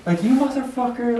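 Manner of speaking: high-pitched mock yell at himself; mock angry yelling. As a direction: imitate a stranger yelling at himself on the train. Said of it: "like, yell and scream".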